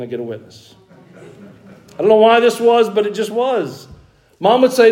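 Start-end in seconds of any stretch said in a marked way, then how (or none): none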